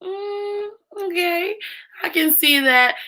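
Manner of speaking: high-pitched, hesitant mock consideration; imitated tentative agreement. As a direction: mimic a group half-convinced, pitched high. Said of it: "everybody was kinda like".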